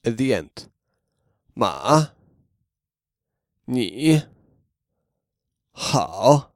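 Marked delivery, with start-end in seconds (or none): 1.5-6.5 Ma, ni, hao low then rising at the end; Ma, ni, hao held low, tail up; third tone. Shown as a demonstration. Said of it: "keep it low and go up at the end".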